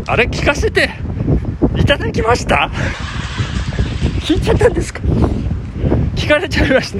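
high, teasing mock surprise; playful surprise. Real, not acted. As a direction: tease the listener, all wide-eyed. Reacts playfully to listener's letter.

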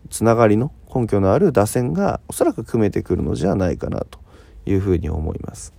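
ordinary talk

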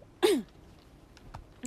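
loud rough throat clear. Real, not acted. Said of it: "声ガラガラだな。".

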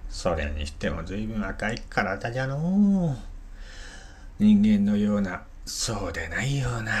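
put-on wondering old hunter; creaky wise old man. Gisuke puzzles at red creatures. (不思議がる老人の声)

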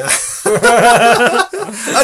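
sudden loud burst of laughter. Real, not acted. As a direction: laugh out hard. Laughing at [name] lyrics memory.

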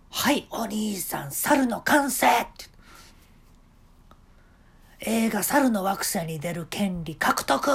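high, loud eager boss voice; a showman giving orders; eccentric shop owner. Retold or imitated. Owner announcing, slow. (店の大将が客に猿になる手順を指示する、高く大きな声)

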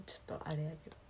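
voiceless whispered aside. Real, not acted. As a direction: drop to a hush as if confiding. Aside about a name.

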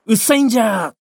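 loud, high, furious yell at a bird; drunk man's shout. Acted. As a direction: bellow it out like a drunk old man. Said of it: "おっさんが、". (酔っ払いの怒鳴り声)

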